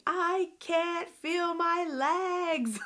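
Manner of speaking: high, very drawn-out mock scream; retold scream. Daughter on the floor to her family, retold scream. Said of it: "sitting there on the floor screaming".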